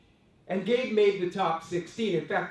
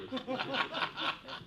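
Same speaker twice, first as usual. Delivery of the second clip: breathy laugh; 7 semitones higher, 7 dB quieter than usual. Real, not acted. Laughing at Barry reveal.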